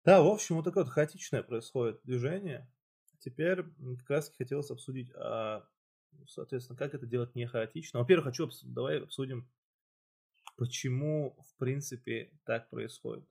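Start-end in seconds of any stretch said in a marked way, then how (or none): none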